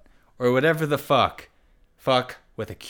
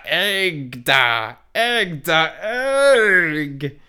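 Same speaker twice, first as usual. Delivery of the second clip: high, raised villain cry; hammed-up villain calling out; villain shout; 6 semitones higher, much slower than usual. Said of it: "he shouted".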